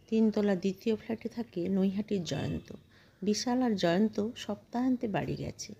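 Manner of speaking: plain reading voice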